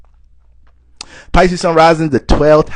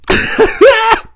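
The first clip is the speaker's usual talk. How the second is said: bursts into a loud, high cackle; real laugh at his own joke; 5 dB louder than usual. Real, not acted.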